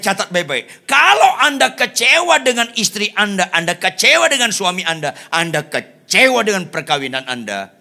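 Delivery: loud, high, insistent pulpit shout; full-force preaching. Real, not acted. Preacher warning disappointed spouses, full force. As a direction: hammer the warning out, drawing out words.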